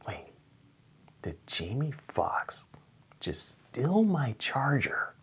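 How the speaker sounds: slow, unvoiced whisper; hushed realization. Real, not acted. Waiter realising his charger is gone, whispering. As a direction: breathe it in disbelief. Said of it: "sudden realisation, whispered".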